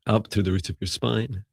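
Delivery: calm, even meditation voice; guided meditation. Acted. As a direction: guide them gently through the breathing.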